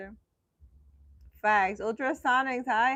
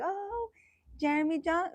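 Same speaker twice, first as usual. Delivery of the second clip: weak, wrong way; 8 semitones higher than usual. Shown as a demonstration. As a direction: say the name lightly, with no weight. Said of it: "you can't even say, like".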